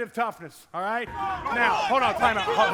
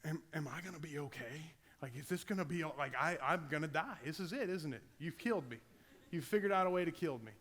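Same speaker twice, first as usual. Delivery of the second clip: put-on quieter, panicked mumble of a scared kid; imitating a terrified kid, softly and in a rush; 4 semitones lower, 8 dB quieter than usual. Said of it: "this, like, child, like".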